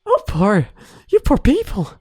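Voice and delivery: high, pitying you poor people; mock pity. Real, not acted. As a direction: coo with exaggerated sympathy. Pities viewers again.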